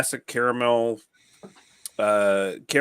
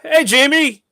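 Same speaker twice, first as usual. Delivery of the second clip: high, loud call to Jamie; loud greeting; 9 dB louder than usual. Real, not acted. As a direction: shout a bright hello.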